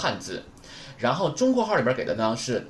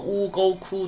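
乌沟哭 held high and level; 6 semitones higher, slower than usual. Shown as a demonstration. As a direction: keep 乌沟哭 on one high, even tone. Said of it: "读一声". (乌沟哭读成平直的高音)

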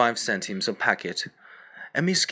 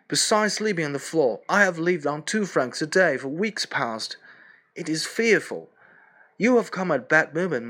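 higher, louder desperate complaint; starving Boris; 5 semitones higher, 3 dB louder than usual. Acted. Boris telling the narrator he is starving: higher desperate voice. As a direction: plead that he is starving.

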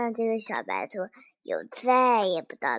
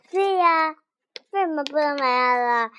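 bunny's loud protest; 5 semitones higher, 7 dB louder than usual. Acted. Bunny talks back to ant king.

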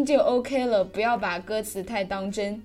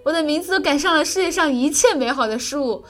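higher, louder, mock-swooning over his own name; self-admiring friend; 6 semitones higher, 4 dB louder than usual. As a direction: gush over your own name like a vain boy. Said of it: "大兵，你别作了". (模仿自恋的大兵，夸张陶醉)

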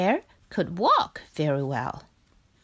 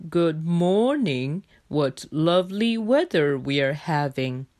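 Bear character voice; 4 semitones lower than usual. A narrator's reading. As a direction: pronounce it like a newly taught little bear. Bear shows off his speech.

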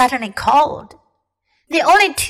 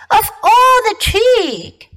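slow, high, outraged huff; indignant; 11 semitones higher, 3 dB louder, slower than usual. A narrator's reading. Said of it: "said Miss Beaver".

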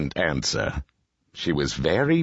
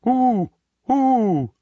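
high, loud imitated hooting; owl's hoot; 6 dB louder than usual. A narrator's reading. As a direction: hoot like an owl. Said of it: "asked the owl".

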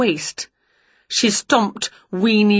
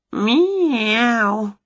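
agreeing meow; 4 semitones higher than usual. A narrator's reading. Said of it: "agreed Wilbur".

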